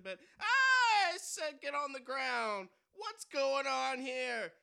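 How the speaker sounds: loud, high robber yell; frantic shouting hostage taker. Acted. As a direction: bark it like a stick-up man. Robber insists.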